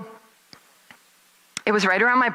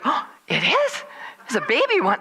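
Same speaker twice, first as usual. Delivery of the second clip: feigned surprise; 7 semitones higher than usual. Acted. Feigns surprise to curious passers-by.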